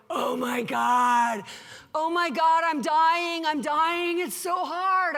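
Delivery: loud, drawn-out mock wail; lamenting. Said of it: "he's, like, just lamenting".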